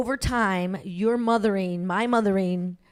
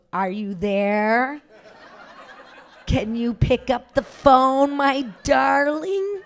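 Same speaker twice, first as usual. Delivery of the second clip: slow, high, sing-song doting call; sweet doting mom; 4 semitones higher, slower than usual. Retold or imitated. Mother calling her daughter's answering machine, sweet sing-song. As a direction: coax her to pick up, sweetly.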